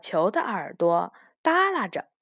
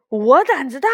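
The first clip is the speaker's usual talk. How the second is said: loud, higher startled question; taken aback; 4 semitones higher, 7 dB louder than usual. A narrator's reading. Banban hears friends praise its courage, taken aback. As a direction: echo the praise in disbelief. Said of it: "斑斑也愣住了".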